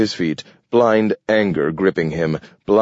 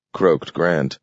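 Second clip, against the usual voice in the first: plain reading voice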